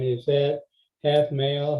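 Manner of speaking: ordinary talk, nothing marked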